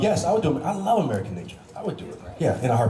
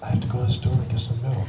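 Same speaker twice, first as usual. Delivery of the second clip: stiff, deadpan Katie Cassidy; Katie Cassidy, no movement; 2 semitones lower than usual. Retold or imitated. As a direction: say it without moving a muscle. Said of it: "No movement".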